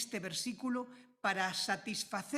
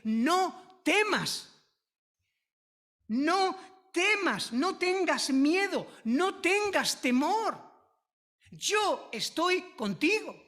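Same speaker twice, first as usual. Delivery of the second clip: slow, raised, forceful preaching; emphatic proclamation; 5 semitones higher, 4 dB louder, slower than usual. Real, not acted. Preacher urging the congregation not to fear, emphatic proclamation. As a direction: proclaim it higher and louder, each phrase drawn out.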